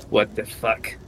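low, soft, stunned disbelief; stunned murmur. Acted. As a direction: say it quietly, as if in shock. Acts stunned character back in reality.

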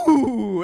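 loud, high imitated whoop; gleeful high "ooh"; excited child's whoop. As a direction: burst out like a toddler suddenly awake. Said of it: "About ten seconds of silence, and he goes".

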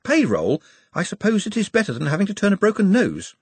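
hint of a nervous assistant; Ponder, cautious. A narrator's reading. Ponder voiced.

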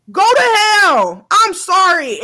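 high, loud furious yell. Real, not acted. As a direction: scream it at her in real anger. Angry rant at celebrity.

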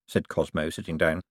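ordinary delivery, nothing marked

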